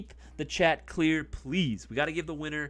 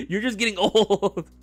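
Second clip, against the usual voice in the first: high, loud tease through a laugh; 6 semitones higher, 9 dB louder than usual. Real, not acted. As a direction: rib him while laughing.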